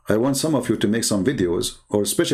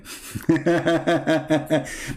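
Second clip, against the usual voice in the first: scornful laugh; 4 semitones higher than usual. Real, not acted. Mocking the answer.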